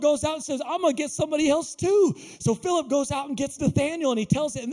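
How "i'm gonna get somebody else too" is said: high, eager declaration; bursting to go tell someone; acted thrill, pitched up; excited resolve. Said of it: "and Philip gets excited".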